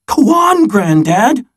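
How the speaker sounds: put-on a kid who can't wait to hear the rest; excited child. Child urges Granddad again.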